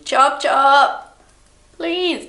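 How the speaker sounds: put-on high, loud parent hurrying kids. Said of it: "I am constantly saying".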